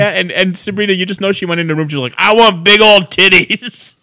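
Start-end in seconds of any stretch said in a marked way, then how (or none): 2.2-3.9 loud, slow, hammed-up wish; exaggerated pretend craving, louder and higher; mock greedy demand. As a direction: blurt it out as a silly, drawn-out demand. Mock quote of imagined classmate's wish.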